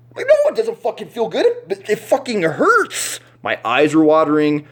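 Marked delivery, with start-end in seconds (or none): 0.3-3.2 very high, loud, slowed complaint; high pained protest. Retold or imitated. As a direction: wail that it hurts. Protesting the punch.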